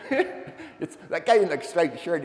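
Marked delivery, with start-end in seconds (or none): 0.0-1.0 laughing through the words, much higher; giggling. Retold or imitated. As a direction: crack up while trying to speak. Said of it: "And he's giggling about it".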